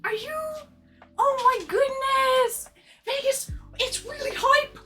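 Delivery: put-on high, babyish damsel pleading; sugary baby talk asking a fan for kisses; cutesy baby voice. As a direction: play the helpless little one begging for rescue. Baby-voiced plea to a viewer.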